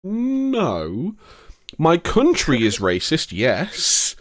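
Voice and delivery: drawn-out, high no, then slow concession; mock emphatic rebuttal. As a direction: stretch it out in exaggerated correction. Acted emphatic reply to accusation.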